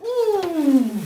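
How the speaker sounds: high, long blowing sound; wolf puffing with all his might; big blowing ooh. Acted. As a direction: blow hard at the house. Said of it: "took another deep breath and he went".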